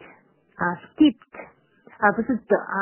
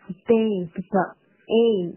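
ordinary talk

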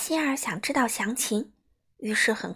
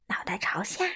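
ordinary delivery

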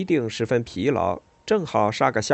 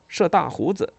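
higher-pitched, mildly acted soldier's call; soldier's shout; 7 semitones higher than usual. A narrator's reading. A soldier answers the general from the ranks, mildly acted shout. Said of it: "有士兵叫".

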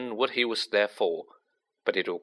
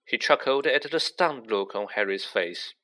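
ordinary delivery, nothing marked

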